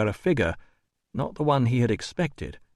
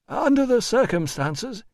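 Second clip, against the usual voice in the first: high, fussy official voice; officious administrator; 12 semitones higher than usual. A narrator's reading. Administrator offering terms to the doctor, high and officious.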